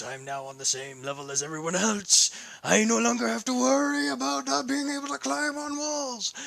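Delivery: building to a high, strained shout; triumphant yell. Acted. Hero walking on walls in new boots, triumphant yell.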